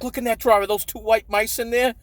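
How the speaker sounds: a hint of a high, alarmed mother; lightly voiced frightened mom pointing at the drawer; imitating panicked mom. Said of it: "She's like".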